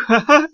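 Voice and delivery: high tittering laugh; sly little snicker; imp's giggle. Acted. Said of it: "Комиссионер захихикал". (мерзкое хихиканье)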